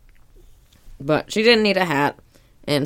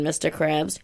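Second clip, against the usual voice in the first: ordinary talk, nothing marked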